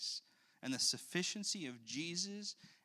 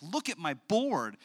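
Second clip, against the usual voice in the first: lightly voiced toddler, slow and proud; little girl; 3 semitones higher, 7 dB louder, slower than usual. Father voicing his little daughter showing off her board. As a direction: show off a toy like a three-year-old.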